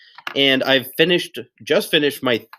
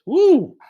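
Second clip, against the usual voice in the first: a high, delighted whoop; excited woo. Acted. Teacher whooping at a juicy word for second graders, excited. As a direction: cheer the new word like a prize.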